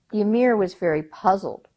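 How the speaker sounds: usual speaking voice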